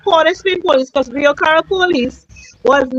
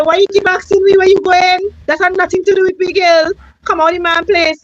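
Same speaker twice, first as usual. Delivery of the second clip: court woman's rude retort; 4 semitones higher than usual. Retold or imitated. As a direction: throw the words at her like the woman from the court did. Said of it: "And she said".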